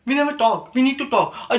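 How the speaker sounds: high-pitched imitation of a needy girlfriend; put-on female lament; mock complaining girlfriend. Mimics girlfriend's relationship complaint.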